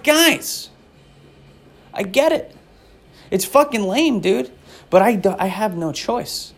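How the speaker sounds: high-pitched mock plea; hammed-up whiny protest; pleading. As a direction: plead with them to go easy. Acted plea to mocking strangers.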